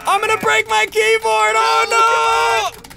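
loud high frustrated yell; raging gamer. Real, not acted. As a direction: rage at the keyboard. Losing tug of war, raging.

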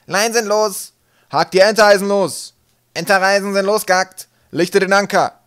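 high, loud ringing shouts; shouted deck calls. A narrator's reading. Captain and crew casting off the ship, shouting. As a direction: holler each call across the deck. Shouted orders and replies at departure.

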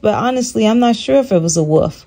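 normal talking voice

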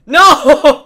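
high shriek breaking into laughter; scream, then crack up; laughing scream. Real, not acted. Laughing scream at a game moment.

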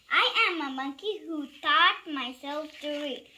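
ordinary delivery, nothing marked

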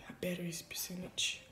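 quick hushed whisper. Real, not acted. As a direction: whisper the aside. Whispered aside about battery.

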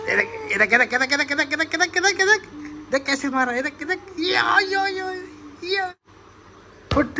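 rising, rapid-fire mock fight cries; pretend beating. Father pretending to hit mum before child, excited mock yells. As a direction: build to high play-acted yelps.

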